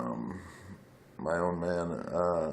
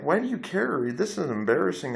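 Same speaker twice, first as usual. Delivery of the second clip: a higher, whiny voice, imitating the embarrassed friends; mocking her friends; 9 semitones higher than usual. The friends asking why he cares, imitated in a higher whine. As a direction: mimic them brushing him off, pitched up. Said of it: "they were all like".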